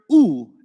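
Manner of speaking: a clear, loud "u" vowel; u sound. Shown as a demonstration. Teacher sounding out "u" for students. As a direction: sing out the vowel "u" for the class. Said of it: "If you hear".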